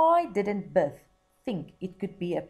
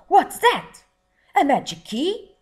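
high, loud astonished cry; surprised shout; 11 semitones higher, 12 dB louder than usual. Acted. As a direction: shout it in amazed greed. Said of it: "shouted Buncombe".

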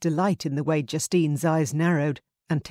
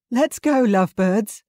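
louder, higher teasing call; called loudly; 6 semitones higher, 6 dB louder than usual. A narrator's reading. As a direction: call out to tease the couple. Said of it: "Rosie said loudly".

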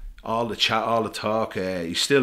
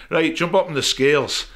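put-on a higher, louder Scottish accent calling out to the keeper; Scottish coach; 8 semitones higher, 6 dB louder than usual. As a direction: call across the room in a put-on Scottish accent. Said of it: "it's a really poxy Scottish accent I'm gonna do".

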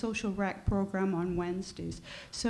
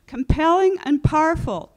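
projected louder into mic; louder repeat; 4 semitones higher, 8 dB louder than usual. Real, not acted. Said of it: "Can you hear me?".

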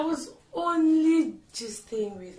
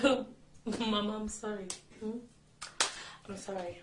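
meek apology; 9 dB quieter than usual. Acted. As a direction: apologise almost under your breath. Wife meekly apologises.

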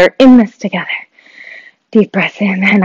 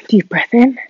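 usual speaking voice

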